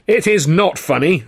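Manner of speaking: slow, high, loud protest; indignant exclamation. Acted. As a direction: snap back, outraged, dragging the words. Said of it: "Sid exclaimed".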